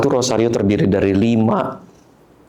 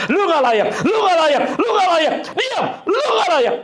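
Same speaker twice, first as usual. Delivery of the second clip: high, escalating condemnation; relentless accusing voice of guilt; judging accusation. Acted. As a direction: hammer out the verdict, rising to a shout. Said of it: "menghakimi kita bilang".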